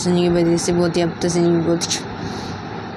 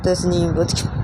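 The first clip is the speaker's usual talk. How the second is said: plain reading voice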